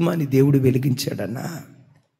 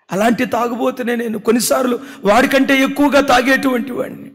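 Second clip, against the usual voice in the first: higher, louder, fervent confession; impassioned confession; 9 semitones higher, 7 dB louder than usual. Retold or imitated. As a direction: cry it out as the friend's admission. Friend's confession preached loudly. (ఉద్రేకంతో ఒప్పుకోవడం)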